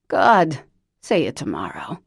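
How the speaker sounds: loud, put-on voice of an old chain smoker; Lavinia's horrible voice. Cousin Lavinia phoning Parker, loud put-on smoker's voice. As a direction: bark it out like a lifelong smoker.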